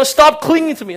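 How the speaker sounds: a loud mock Jesus; comic rebuff. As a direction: order her to let go. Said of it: "you know".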